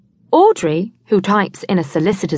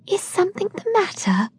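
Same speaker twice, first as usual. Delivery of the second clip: anxious; 12 semitones higher, 5 dB quieter than usual. A narrator's reading. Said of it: "asks Sylvia anxiously".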